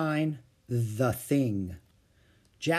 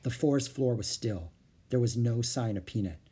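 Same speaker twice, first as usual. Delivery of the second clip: plain reading voice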